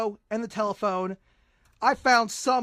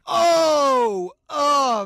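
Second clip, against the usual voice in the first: excited; 4 semitones higher, 10 dB louder than usual. Real, not acted. Excited reaction to Father's Day joke.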